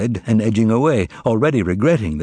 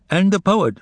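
plain reading voice

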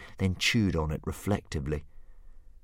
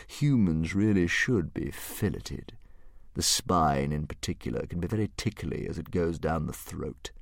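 thoughtful dragon; 2 semitones lower, 4 dB quieter than usual. A narrator's reading. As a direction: savour each word like a gourmet monster. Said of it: "continued the dragon thoughtfully".

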